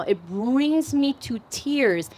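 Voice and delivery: slow, choked-up words; holding back real tears; tearing up. Real, not acted. Organizer speaking of women's breakthroughs, tearing up. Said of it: "I'm tearing up right now".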